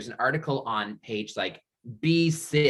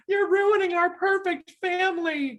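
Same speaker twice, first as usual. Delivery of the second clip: high, wailing mock-distraught sister; hammed-up high cry of despair; sister's dramatic wail; 4 dB louder than usual. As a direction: wail it like a devastated little sister. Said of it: "and this part stuck with me".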